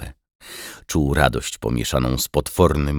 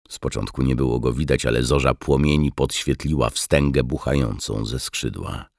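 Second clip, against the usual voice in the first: usual speaking voice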